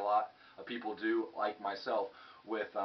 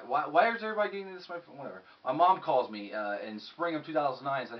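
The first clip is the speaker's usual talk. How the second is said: ordinary delivery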